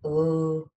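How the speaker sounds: "Oh" as a long Teesside O. Shown as a demonstration. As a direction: stretch "Oh" the Teesside way. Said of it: "the Teesside O is very, like".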